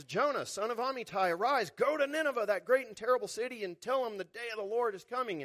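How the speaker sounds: God sending Jonah to Nineveh; commanding God. Retold or imitated. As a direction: thunder the command from on high.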